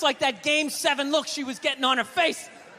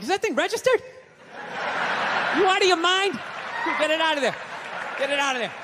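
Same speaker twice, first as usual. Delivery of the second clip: lightly acted incredulous friend, pitched up; mock alarm, scolding a clueless pal; 7 semitones higher than usual.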